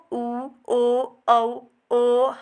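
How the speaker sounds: उ, ओ, औ sounded with rounded lips; rounded vowel demo. Shown as a demonstration. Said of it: "ये अगर हम बोलते हैं".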